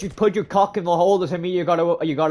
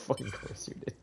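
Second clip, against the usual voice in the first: whispered aside; 3 semitones lower, 16 dB quieter than usual. Real, not acted. Muttering a comeback to himself.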